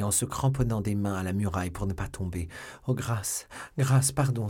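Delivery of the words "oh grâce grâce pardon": rising, louder plea for mercy; begging for mercy. A narrator's reading. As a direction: beg on your knees, voice climbing. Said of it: "s'écria la misérable".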